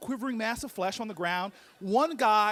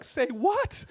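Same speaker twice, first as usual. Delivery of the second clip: higher, incredulous question; incredulous reply; 4 semitones higher, 3 dB louder than usual. Retold or imitated. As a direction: react in mock disbelief. Body's startled reply to ego.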